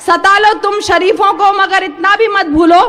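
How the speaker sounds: measured Urdu verse, each phrase projected; poetry recital. Acted. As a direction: declaim the couplets to an audience. Poet recites couplets to audience.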